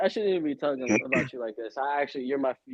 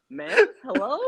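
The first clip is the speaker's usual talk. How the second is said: mock call; 12 semitones higher, 5 dB louder than usual. Mock calling out.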